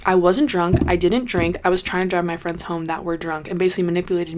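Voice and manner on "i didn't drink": ordinary delivery, nothing marked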